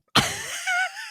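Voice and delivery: a short high burst of laughter; quick amused laugh. Real, not acted. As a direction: laugh at your own joke.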